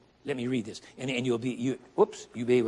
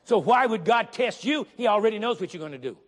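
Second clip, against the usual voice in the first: preaching; 5 semitones higher, 4 dB louder than usual. Acted. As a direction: press the question on them. Preaching climax.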